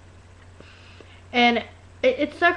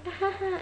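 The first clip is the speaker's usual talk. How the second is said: small, soft, high fake chuckle; fake laugh; 8 semitones higher, 10 dB quieter than usual. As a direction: give a quiet, insincere little laugh. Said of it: "they do that fake laugh".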